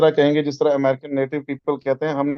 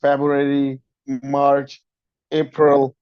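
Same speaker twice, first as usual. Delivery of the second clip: जनवरी as Jan-yoo-ary in American style; American accent; American pronunciation; much slower than usual. Shown as a demonstration. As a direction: model the months the American way. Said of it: "we learned कि हमने उनको कैसे कहना है".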